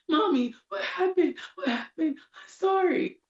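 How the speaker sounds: high, teary child's plea, imitated; little girl voice. Mother voicing her daughter saying sorry, high teary child voice. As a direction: play a small daughter apologizing through mock tears, pitched high. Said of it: "she's like".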